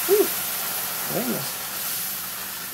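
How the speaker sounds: breathy gasp after touching something hot; hot pan gasp. Real, not acted. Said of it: "That's hot".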